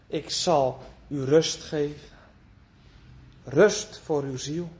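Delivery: low and slow, gentle and reassuring; tender promise. Acted. Preacher voicing God promising rest, low and tender.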